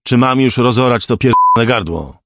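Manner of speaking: loud, high menacing shout; angry threat. A narrator's reading. Composer threatening to kill the hostage, angry shout. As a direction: threaten at full voice.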